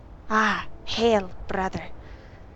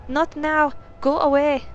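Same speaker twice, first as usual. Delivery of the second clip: higher and louder, panicked shout; frightened shout; 7 semitones higher, 6 dB louder than usual. Acted. Startled maid shouting at the lieutenant at the door, panicked shout. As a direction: yell the stranger away from the door. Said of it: "She screams".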